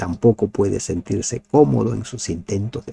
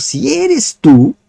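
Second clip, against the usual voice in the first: high, slow, louder doubting cry; Peter's doubtful plea; 13 semitones higher, 9 dB louder, much slower than usual. Acted. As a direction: stretch it out high as if unsure. Pedro duda ante Jesús. (pregunta alta y lenta, llena de duda)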